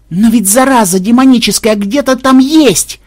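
high, loud, agitated outburst; frenzied retort. A narrator's reading. Programmer answers while ramming the wall, frenzied retort. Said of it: "Не переставая таранить головой стену, отозвался Ксенобайт".

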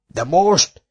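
high, exasperated yell. Acted. Foreman yelling at the old man, exasperated. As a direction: throw the question at him. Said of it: "üvöltötte Garai Micu".

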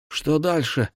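shouted high over a waterfall's noise; yelling over roaring water. A narrator's reading. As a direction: call it out high above the roar. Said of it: "проорал Ирт".

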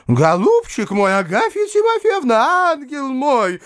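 very high, fussing lady's wail; frantic wailing cry. A narrator's reading. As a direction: wail it out in high-pitched alarm. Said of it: "кричала Анфиса Петровна".

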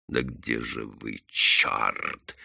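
low, hushed impatient curse; раздражённо вполголоса. A narrator's reading. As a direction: curse quietly while searching. Irritated search on deck, voice lowered. (сердито и тихо)

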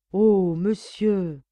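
drawn-out mock-shocked exclamation; coy outcry. A narrator's reading. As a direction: stretch it out, feigning shock. Maid's coy exclamation, slow, loud.

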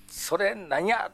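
Kansai reaction. Retold or imitated. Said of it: "とか言いましたけどね".

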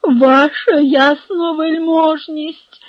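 high, slow, loud wail; drawn-out, anguished begging; groaning plea. A narrator's reading. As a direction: moan it out in desperate appeal. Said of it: "простогнав Барабаш".

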